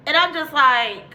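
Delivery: ordinary talk, nothing marked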